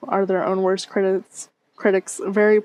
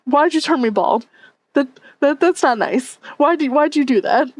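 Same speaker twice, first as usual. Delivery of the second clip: a lightly higher, whiny take on her own voice; mock annoying voice; 6 semitones higher than usual. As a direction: lightly parody how annoying you think you sound. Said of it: "I think my voice is annoying as shit".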